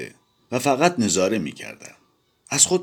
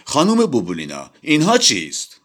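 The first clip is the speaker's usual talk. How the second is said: disgusted; 8 semitones higher, 5 dB louder than usual. A narrator's reading. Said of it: "با حالت انزجار پرسید".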